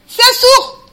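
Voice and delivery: crié haut et fort, indigné; lance-le comme un cri de révolte. Acted. Prêche, imite l'exclamation. (exclamation criée, indignée)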